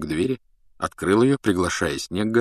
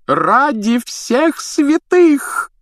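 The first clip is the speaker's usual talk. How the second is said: high, loud, drawn-out exclamation; wailing outcry; 6 dB louder, much slower than usual. A narrator's reading. Matron protesting yet another visitor, crying out. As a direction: wail in exasperation. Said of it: "возопила мадам Помфри".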